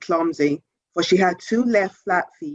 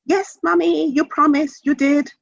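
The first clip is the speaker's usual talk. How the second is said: put-on much higher child voice; eager child; 9 semitones higher than usual. A narrator's reading. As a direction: chime in like an excited little kid. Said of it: "the middle child ... joined in".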